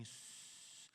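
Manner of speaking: soft drawn-out hiss; quiet voiceless shushing sound; imitated shush. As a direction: hush the listener with a long "sss". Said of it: "Ele fez assim pra mim".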